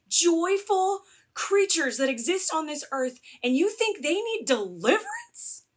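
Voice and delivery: high-pitched, emphatic outrage; exasperated protest, stretched key words; indignant rant. Real, not acted. Indignant rant about children being delivered.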